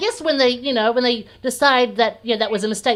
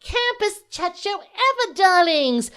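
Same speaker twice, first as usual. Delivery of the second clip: high, drawn-out diva host; campy drag queen; 8 semitones higher, slower than usual. Acted. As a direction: play a vain, fabulous drag host.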